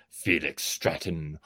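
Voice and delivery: Felix Stratton said low and slow, deep growl. Shown as a demonstration. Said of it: "I go".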